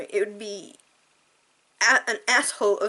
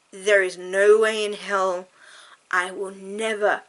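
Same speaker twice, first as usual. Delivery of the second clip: emphatic vow; 7 dB louder than usual. Real, not acted. Vowing to viewers and counselors.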